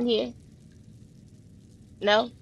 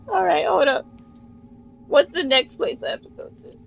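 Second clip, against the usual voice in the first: ordinary talk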